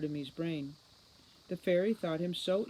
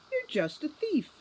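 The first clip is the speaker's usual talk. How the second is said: higher and louder, full of contempt; spat accusation; 12 semitones higher, 5 dB louder than usual. A narrator's reading. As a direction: throw the insult in his face. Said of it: "spat Holly".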